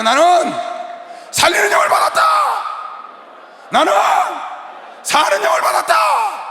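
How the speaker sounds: rising preacher shout; call to repeat. Shown as a demonstration. Preacher leads the congregation in a shouted declaration. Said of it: "따라하세요".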